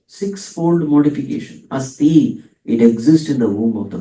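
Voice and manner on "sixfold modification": ordinary delivery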